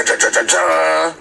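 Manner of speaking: normal talking voice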